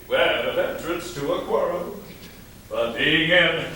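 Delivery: ordinary delivery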